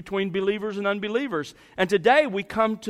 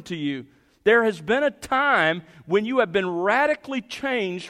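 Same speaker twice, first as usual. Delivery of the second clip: impassioned preaching; 4 semitones higher than usual. Acted. Preacher urging the congregation about their change, fervent. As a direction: drive it home from the pulpit. Preacher pressing the point home.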